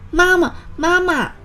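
high-pitched tadpole call for mother; little tadpoles crying out "mama". A narrator's reading. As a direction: call out high, chasing after mum. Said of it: "追上去就喊".

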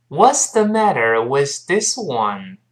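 slow, evenly spaced teaching model; slow reading. Shown as a demonstration. Teacher leading learners through the sentence, slow reading. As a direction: read it out slowly, word by word. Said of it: "我们慢慢读一下这句话".

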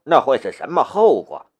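ordinary delivery

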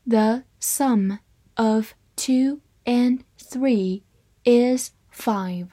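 slow, word-by-word model reading; measured dictation pace for learners; slow read-aloud. Shown as a demonstration. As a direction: separate every word with a short pause. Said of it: "好，慢慢来读".

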